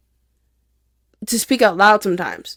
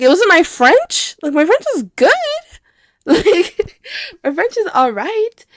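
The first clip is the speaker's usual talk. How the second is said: indignant self-defence; 9 semitones higher, 3 dB louder than usual. Retold or imitated. Defending your French to laughing boys, mock-indignant. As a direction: protest it high, breaking into a laugh.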